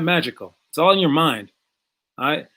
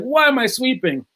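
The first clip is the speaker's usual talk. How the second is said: higher, whiny complaint from the student; annoyed kid; 8 semitones higher than usual. Retold or imitated. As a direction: grumble up high like a fed-up teen. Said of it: "He's like".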